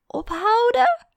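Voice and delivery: slow, loud, high disbelief; drawn-out, astonished question; incredulous echo. A narrator's reading. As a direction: repeat it as if it were absurd. Girlfriend incredulous, about to laugh.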